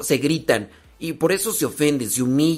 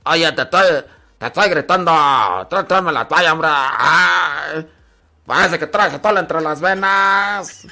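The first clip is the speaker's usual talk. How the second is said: fuerte y exigente, casi a gritos; regáñala por lenta, en voz alta; esposo regañón; 3 dB louder than usual. Acted. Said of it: "pero ya se casan". (esposo gritándole a la esposa por la toalla, fuerte)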